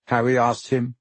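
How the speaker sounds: plain reading voice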